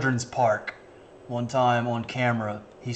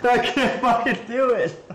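laughing; 9 semitones higher than usual. Real, not acted. Overwhelmed laughing at the clip.

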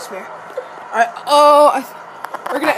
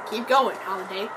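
ordinary talk, nothing marked

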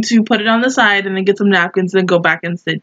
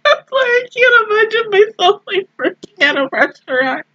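laughing; 11 semitones higher than usual. Real, not acted. Laughing retelling, high pitch.

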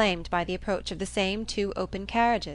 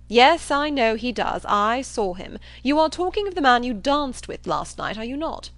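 raised, emphatic assertion; blustering John Thorpe; 5 semitones higher, 6 dB louder than usual. Acted. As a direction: insist with brash certainty. Thorpe insists he saw him.

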